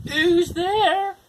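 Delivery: very high, loud squeaky call; second pig calling to the knocker. Acted. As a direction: call through the door. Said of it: "called the second little pig".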